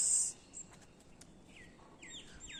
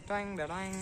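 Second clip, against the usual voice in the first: imitated twang; 5 semitones higher than usual. Mimicking the unboxing sound.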